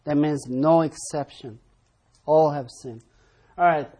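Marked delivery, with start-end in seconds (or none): 2.2-3.0 All punched, falling firmly; stress on all. Shown as a demonstration. Teacher drilling the class on the verse, All stressed. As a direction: lean on All, then let sinned drop. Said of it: "Emphasis on all".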